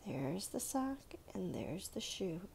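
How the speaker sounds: soft whisper. Real, not acted. Whispered after finding.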